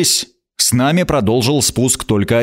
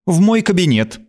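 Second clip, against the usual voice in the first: sharp, higher command; 8 semitones higher than usual. Acted. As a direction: snap the order. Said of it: "велела она резко".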